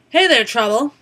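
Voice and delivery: put-on loud, cheeky old-lady greeting; Martha's greeting. Old customer greeting the cashier, loud and cheeky. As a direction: call out to the new cashier with sass.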